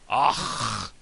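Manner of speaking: loud, rasped throaty "ach"; spitting throat sound. Shown as a demonstration. Teacher showing learners "ach", said like spitting. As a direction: hit "ach" hard in the throat like you're about to spit. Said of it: "like you're gonna spit".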